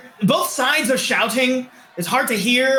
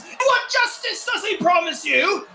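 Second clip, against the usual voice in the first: higher fiery agitator voice; rabble-rouser; 9 semitones higher than usual. Acted. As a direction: whip the crowd up against the crown. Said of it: "And then another one goes".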